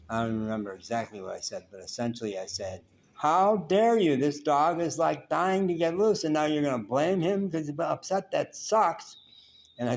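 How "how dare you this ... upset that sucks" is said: loud, high, furious retelling of the outburst; angry outburst. Retold or imitated. Yelling at the waitress over the mistreated dog, furious. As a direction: blow up at her, raising the voice hard. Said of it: "I blew. I said it much louder and more strident".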